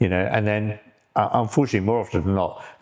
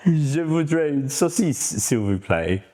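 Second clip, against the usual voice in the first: slow, higher French accent; 5 semitones higher, slower than usual. Shown as a demonstration. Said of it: "say it with a French accent".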